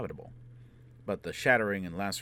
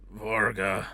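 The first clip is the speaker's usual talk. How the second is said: hoarse croaked name, louder; 2 semitones higher, 8 dB louder than usual. Acted. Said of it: "Foyle croaked".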